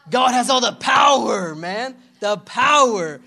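loud, emphatic preacher's shout; booming conviction, leaning on POWER; like you mean it. Real, not acted. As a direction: hammer POWER long and hard. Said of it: "say it like you mean it".

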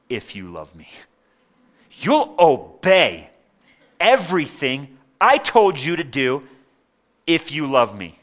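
a harsh master laying down the law; stern demanding God. Retold or imitated. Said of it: "I heard it like this".

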